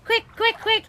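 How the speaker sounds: excited urging. Real, not acted.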